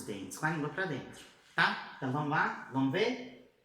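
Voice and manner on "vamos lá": normal talking voice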